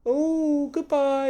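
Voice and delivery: very high sing-song mock farewell; sneering, lilting goodbye. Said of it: "he kind of mocked me".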